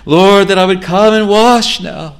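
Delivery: loud, high cry of "Lord, Lord"; pleading sinner's prayer. Acted. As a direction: cry out like a desperate sinner begging to be saved.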